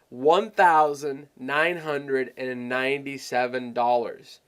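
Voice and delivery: "one thousand nine hundred and ninety-seven" said slowly, each part weighed; drawn-out big price. Shown as a demonstration. Seller quoting the normal investment, drawn out to sound like a lot of money. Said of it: "you have to say it like this".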